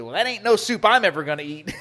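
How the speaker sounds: mock disgust. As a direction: play the butcher turning her nose up, half laughing. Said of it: "this look of disgust".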